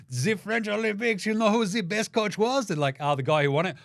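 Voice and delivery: high, haughty mock French accent. Mocking French officials picking their coach, high French accent. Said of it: "such a fucking French thing".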